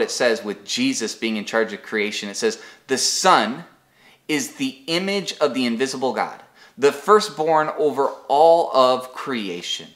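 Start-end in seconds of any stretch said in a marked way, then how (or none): 2.9-9.9 scripture reading. Acted. Pastor reading Colossians to the congregation, solemn and building. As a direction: proclaim the verses with weight.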